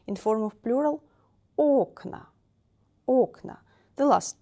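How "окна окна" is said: окна with first о punched high and loud; stress on first O. Shown as a demonstration. Teacher drills the plural, first-vowel stress. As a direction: hit OK in OKna, then fall. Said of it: "The first vowel is stressed".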